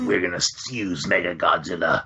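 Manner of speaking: low, smoked-out mobster mumble; low-pitched smoker's villain voice; chain-smoking Marlon Brando. Retold or imitated. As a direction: imitate a chain-smoker playing alien boss. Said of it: "chain smoking Marlon Brando with his birthmark".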